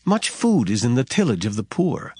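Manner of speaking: normal talking voice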